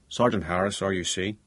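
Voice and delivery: Belfast policeman giving his name; Belfast accent. A narrator's reading. Police sergeant answering the family's phone, Belfast accent. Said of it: "a man with a strong Belfast accent".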